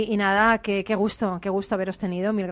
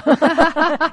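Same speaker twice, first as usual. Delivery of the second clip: loud laugh; 6 semitones higher, 8 dB louder than usual. Real, not acted. Laughs at own confession.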